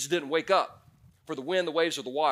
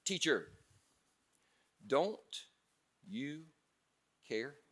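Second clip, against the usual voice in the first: quiet, drawn-out plea; 9 dB quieter, much slower than usual. Retold or imitated. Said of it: "woke him up shouting".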